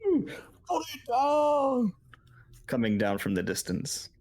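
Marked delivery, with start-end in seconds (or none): 0.0-1.9 high, drawn-out mock holler; high-pitched hollering shout; distant hollering. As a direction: whoop and call out like someone across a camp. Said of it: "sounds like people hollering".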